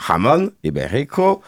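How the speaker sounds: stretched mock-Spanish accent, pitch swooping up on each word; exaggerated Spanish accent. Shown as a demonstration. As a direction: draw out "jamón ibérico" in a showy Spanish accent. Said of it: "Désolé pour mon accent espagnol".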